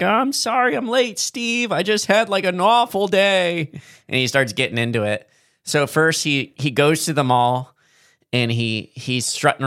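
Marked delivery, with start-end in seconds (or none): 0.0-3.7 high, whiny put-on voice; professor voice. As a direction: play a nervous nerd. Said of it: "And he's like".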